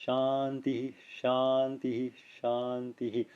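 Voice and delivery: softly said shanti, each ending in an echoed hi; quiet shanti-hi, three times over; clear visarga echo. Shown as a demonstration. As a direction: give every shanti a short breathy hi after it. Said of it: "clear visarga echo of the preceding vowel".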